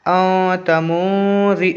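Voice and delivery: "औ तमूजी" slowly, both n sounds hummed in the nose; ikhfa nasal hold. Shown as a demonstration. Teacher reads the joined word, ikhfa nasal hold. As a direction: linger nasally on "औ" and "मू". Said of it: "आवाज़ को नाक में रोक कर पढ़ेंगे".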